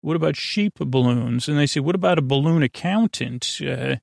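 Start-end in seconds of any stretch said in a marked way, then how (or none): none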